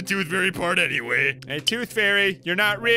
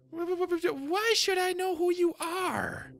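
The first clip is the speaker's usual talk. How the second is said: much higher, slow, stuttering nervous voice; flustered stammering character. Acted. Game character caught off guard, stammering in a high voice. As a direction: stammer it out like a startled little creature.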